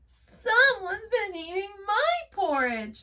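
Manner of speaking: high, loud tearful little bear; crying cub. A narrator's reading. Baby Bear sees his porridge gone, high and crying. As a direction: whimper it as a sad cub. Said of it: "said Baby Bear".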